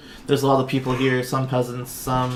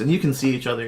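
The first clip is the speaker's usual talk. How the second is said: normal talking voice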